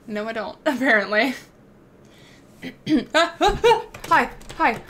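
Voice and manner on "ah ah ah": high laugh. Real, not acted. Laughing at chat joke.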